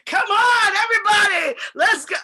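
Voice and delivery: very high, loud party shout; rallying shout. Retold or imitated. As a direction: yell it out to the whole room. Celebrity rallying the crowd.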